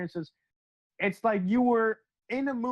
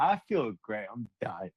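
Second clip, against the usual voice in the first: imitating dazed bliss, low; low voice, blissed-out, trailing down; blissfully happy; 8 semitones lower than usual. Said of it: "Why are you so happy about it?".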